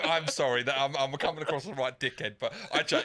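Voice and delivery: talking through real laughter; real giggling under the words; laughing apology. Real, not acted. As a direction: apologise while still laughing. Laughing apology for own joke.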